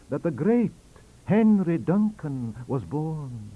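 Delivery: lightly voiced Scots, high and loud; Scots professor. Swinton arguing with Wilson, mild Scots voice. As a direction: press the greater anniversary. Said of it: "cried Professor Swinton".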